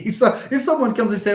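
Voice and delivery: ordinary delivery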